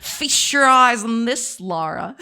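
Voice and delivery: slow, loud villain drawl; Natla. Acted. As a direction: gloat like a theatrical game villain. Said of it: "Just do that Tomb Raider villain".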